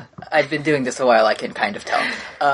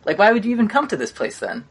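higher, baffled and exasperated; why are you even here, boss asks; annoyed incredulous boss; 8 semitones higher than usual. Acted. GM voices annoyed Admiral.

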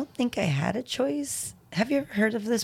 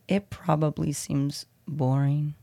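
ordinary delivery, nothing marked

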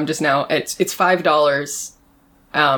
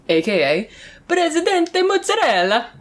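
put-on high, hammy Italian accent; 11 semitones higher than usual. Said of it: "it's never racist to do an Italian accent".

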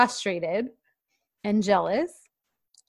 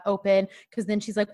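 ordinary talk, nothing marked

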